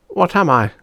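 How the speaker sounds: put-on high curious boy; a little boy wondering; boy's voice. Bob asking his sister, high voice.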